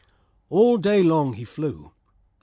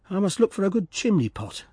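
lightly raised, decided swallow; 6 semitones higher than usual. Acted. Swallow deciding to fly off, lightly raised. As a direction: say it a little higher, resolved.

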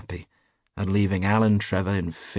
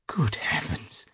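slow, airy gasp of horror; dismayed; 3 semitones higher, slower than usual. A narrator's reading. Hughie realising his blunder, gasping in dismay. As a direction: let it out slowly, aghast. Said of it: "the picture of dismay".

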